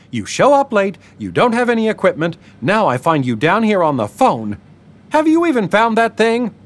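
angry client scolding Marlon for being late, high-pitched; angry woman scolding. A narrator's reading. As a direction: berate him like a fed-up client. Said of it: "a woman's angry voice".

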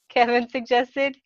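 usual speaking voice

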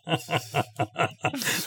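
sheepish giggle after a flub; embarrassed laugh. Real, not acted. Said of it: "I knew I was gonna screw it up".

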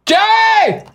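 very high, drawn-out holler; calling out. Real, not acted. Co-host echoing the summons to colleague, high holler. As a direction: yell the name high to fetch someone.

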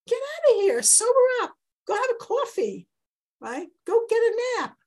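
high, loud scolding shout; Eli shouting her away. Acted. Eli throws out a woman he thinks is drunk, shouting. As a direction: bark her out of the temple like a fed-up priest.

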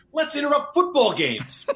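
high-pitched, slow mock eureka; hammed-up brainwave, voice way up; mock enthusiasm. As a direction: pitch a silly idea with laughing glee. Mock idea at imagined strategy session.

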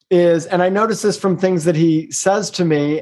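normal talking voice

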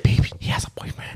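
imitating a soft, breath-only confiding; hushed gossip. Telling partner the gossip, retold in a hushed voice. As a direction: half-whisper it like juicy news.

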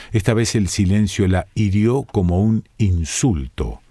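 ordinary delivery

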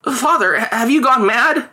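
high, stammered disbelief; incredulous child, high voice; protesting boy. A narrator's reading. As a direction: protest to your father, pitched right up and drawn out. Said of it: "he protested".

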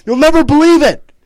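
high, loud excited cry; excited shout. Pretend. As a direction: burst in shouting the news. Gabe bursts in with news.

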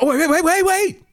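high, shouty mock instructor voice; mocking the trainer. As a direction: squeal it like a panicky know-it-all trainer. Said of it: "This is how he's talking to me".

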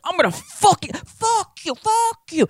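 high-pitched mock rage, hammed up; mock furious yelling. Angry shopkeeper imagines the flip-off, mock furious yelling. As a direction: screech it like a guy losing it.

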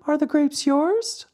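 very high little glowworm cutting in; questioning glowworm. Acted. Glowworm challenges the puppet over grapes, very high. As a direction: interrupt in a tiny, high voice.

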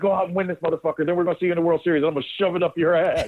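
high, fast, cocky ribbing; hyped trash talk. Retold or imitated. Teammate ribbing the star from behind home plate, high cocky trash talk. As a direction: hype him up then threaten him, all in fun. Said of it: "He goes".

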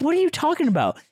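high-pitched mock confusion; retold bafflement. Answering his mad friend, mock baffled. Retelling own baffled reply to Ted.

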